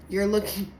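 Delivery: normal talking voice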